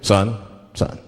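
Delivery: low, measured cop voice; police officer. Acted. Cop to a pulled-over driver, low and official. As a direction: play a stern traffic cop, pitched well down. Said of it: "the police pull me over".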